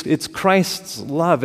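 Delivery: slow, each word weighed; slow emphasis. Real, not acted. Preacher slowly lands the sermon's central line to the congregation. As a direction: lay the phrase down deliberately. Slowed key phrase repeated.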